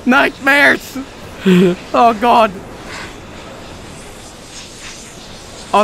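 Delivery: high nervous screams with laughs; panicked, laughing through the fear; scared horror game streamer. Real, not acted. Monsters chasing, nervous laughter.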